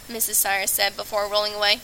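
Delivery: ordinary delivery, nothing marked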